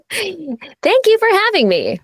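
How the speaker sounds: high, slow text-to-speech voice; chirpy TikTok narrator voice, slowed right down; Jessie voice. Pretend. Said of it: "No, I'm kidding. I won't do the whole interview like that".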